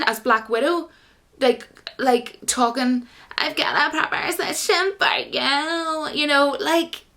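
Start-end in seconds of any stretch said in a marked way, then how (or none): 3.4-7.0 higher, slow, put-on Valley girl drawl. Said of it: "Why does she speak like a Valley girl".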